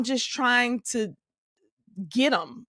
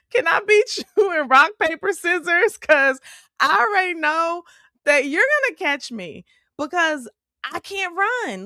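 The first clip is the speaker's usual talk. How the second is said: laughing plea; 8 semitones higher than usual. Retold or imitated. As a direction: laugh through it at your own sad plan. Retelling plea to son, laughing.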